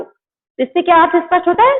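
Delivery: ordinary delivery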